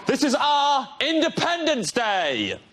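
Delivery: a rousing Scottish battle cry; Scottish accent. Acted. Comedian on a panel show reading the sci-fi line in a Scottish accent. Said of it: "imagine this in a Scottish accent".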